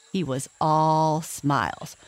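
ordinary delivery, nothing marked

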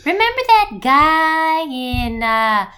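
oily creeper luring you in; creepy snowman voice. Acted. Mom demonstrating the snowman voice her husband invented, high and creepy. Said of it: "He sounds kinda like".

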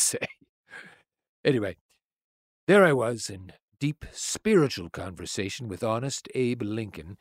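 usual speaking voice

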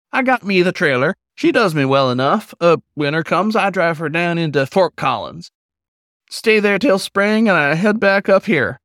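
put-on high, friendly old-timer hello; a kindly old drifter calling out; old wolf, folksy greeting. Old wolf greets the pup at the campfire, folksy and high.

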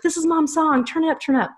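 put-on high-pitched kid's excited demand; imitating excited daughter. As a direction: pitch it way up like an eager little girl. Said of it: "she's like".

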